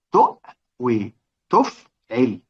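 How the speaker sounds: تؤوي drawn out very slowly, then تفعل as its pattern; slow weighing demo. Shown as a demonstration. Teacher matching تؤوي to its pattern for students, slowly. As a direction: lay the word beside its pattern, slowly. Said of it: "لما نيجي كلمة بقى تؤوي".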